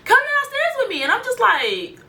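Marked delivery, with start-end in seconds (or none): 0.0-1.0 put-on squeaky high girly voice, much higher; really high pitched voice. Friend imitating Anna's high voice calling her downstairs. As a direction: mimic her sudden high-pitched excitement. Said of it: "has, like, a really high pitched voice".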